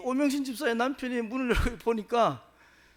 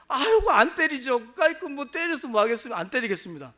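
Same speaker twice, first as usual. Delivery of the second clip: louder, higher, fawning promise with a laugh; eager grovelling; 3 semitones higher, 7 dB louder than usual. Retold or imitated. Husband eagerly promising the mayor's wife, loud fawning laugh.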